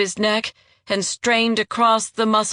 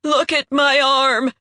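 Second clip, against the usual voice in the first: higher, louder desperate cry; 6 semitones higher, 4 dB louder than usual. Acted. Wounded traitor crying out to Jandara to see his brand, higher and louder. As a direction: cry it out, begging her to look. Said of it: "he cried".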